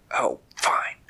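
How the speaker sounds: low whispered hiss of annoyance; grudging snake. Acted. Poisonous snake giving in to Terry, in a grudging hiss. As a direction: sigh it out like a sulky snake.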